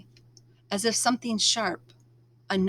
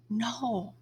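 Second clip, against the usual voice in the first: whisper; 2 semitones higher, 8 dB quieter than usual. A narrator's reading. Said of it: "I whisper".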